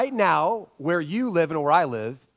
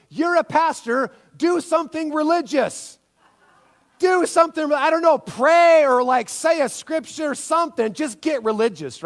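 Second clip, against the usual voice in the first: panicked plea; 10 semitones higher, 4 dB louder than usual. Retold or imitated. Panicked wife pleads with pastor.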